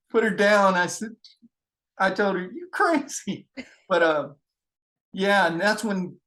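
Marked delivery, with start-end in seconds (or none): 2.4-3.5 high, slow, drawn-out mock scolding; teasing disbelief. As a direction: tell her she's crazy, half laughing at it. Teasing friend after prank.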